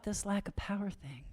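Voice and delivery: hushed whisper, leaning in. Real, not acted. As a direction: whisper it like a secret. Sudden whisper.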